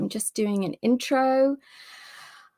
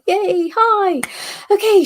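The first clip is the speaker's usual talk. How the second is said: usual speaking voice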